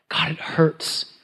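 slow, breathy groan of pain; groaning whisper. Acted. Sufferer groaning to God, pained whisper. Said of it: "The groanings of Israel".

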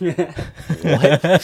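can't believe the coach means it; laughing disbelief. Retold or imitated. Freshman told he will bat ninth, laughing in disbelief.

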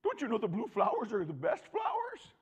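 high, loud whiny goat voice; stubborn goat. Pretend. Preacher voicing a stubborn goat to congregation, high whine. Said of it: "The goat says".

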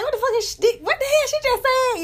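high-pitched incredulous squeal; mock disbelief. Mimicking a Southern friend's confusion, high incredulous squeal. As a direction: act baffled by a friend. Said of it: "You be like".

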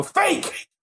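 very high-pitched imitation of a rash guesser; mock eager blurt. Copying a rival's early guesses, high mock blurt. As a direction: squeal it out like a gamble. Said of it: "play the pixie wheel and go".

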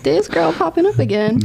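half laughing at the coincidence; amused disbelief. Retold or imitated. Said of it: "And I was like".

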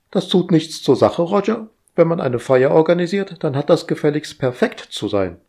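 high, bossy, busy voice; fussy household robot. Acted. Household AI fussing at Roger, high and bossy. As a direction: nag like an overeager house AI.

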